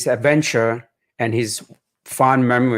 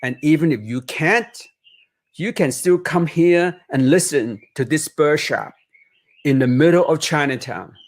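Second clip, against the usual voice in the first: put-on reading aloud to children, lively and higher; picture book narration; 4 semitones higher than usual. A narrator's reading. Author reading his Chinatown memoir to children, expressive storytelling. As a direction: paint each image for young listeners.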